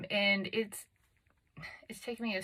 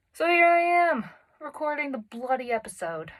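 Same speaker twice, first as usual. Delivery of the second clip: loud, higher burst: here I am; triumphant shout; 7 semitones higher, 9 dB louder than usual. Real, not acted. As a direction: announce it like a victory. Excited announcement after self-pep talk.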